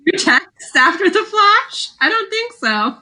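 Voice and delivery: high, scoffing disbelief; incredulous. Real, not acted. Doubting the texting plan to friends, high incredulous scoff. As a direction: scoff at the idea.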